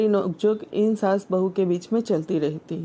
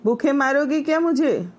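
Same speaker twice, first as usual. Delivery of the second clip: higher, half-hearted yell; mother-in-law shouting, only lightly raised; hungry mother-in-law yells for food, mild mock yell; 6 semitones higher than usual. A narrator's reading. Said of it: "सास फिर चिल्लाई".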